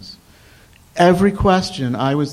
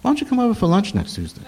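higher and quicker, a lightly voiced invitation; Maurice's invitation; 8 semitones higher, much faster than usual. Maurice inviting the young caller to lunch: quick, lightly higher. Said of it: "heard Maurice's voice say to me".

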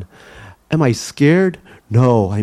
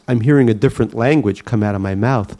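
usual speaking voice

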